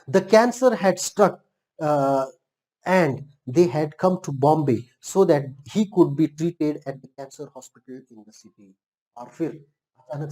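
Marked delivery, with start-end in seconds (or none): 0.1-8.7 slow, deliberate read-aloud; reading text aloud. A narrator's reading. Teacher reads the English story to learners, reading aloud. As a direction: read it out slowly, phrase by phrase.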